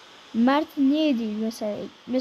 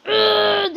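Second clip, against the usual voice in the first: high, loud mocking strained grunt; imitated character grunt; 8 semitones higher, 6 dB louder than usual. Gamer mocking his character's grunt, high and loud. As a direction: mimic the character's annoying strain noise. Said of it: "de ses yapıyor ya".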